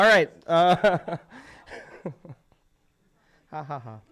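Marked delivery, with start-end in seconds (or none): none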